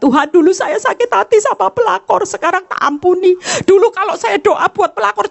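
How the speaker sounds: lightly voiced high praying woman; a touch of a churchgoing wife at prayer; mock prayer of a wife. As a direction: play a repentant wife praying, half mocking.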